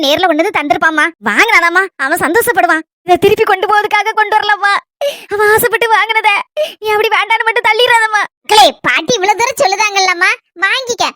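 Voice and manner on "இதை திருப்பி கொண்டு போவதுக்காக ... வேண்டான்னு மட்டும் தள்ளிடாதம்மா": crying as she pleads; tearful pleading. Acted. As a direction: plead through sobs for the gift to be accepted. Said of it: "அழாதுங்க பாட்டி".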